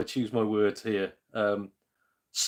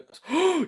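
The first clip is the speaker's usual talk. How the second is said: gasp; 6 dB louder than usual. Real, not acted. Reacts to fifty p deal.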